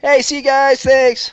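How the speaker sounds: re-enacted called goodbye. Waving off friends who bought the burrito, calling out.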